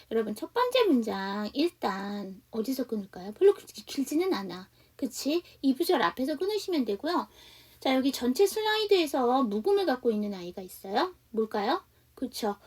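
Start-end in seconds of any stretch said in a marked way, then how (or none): none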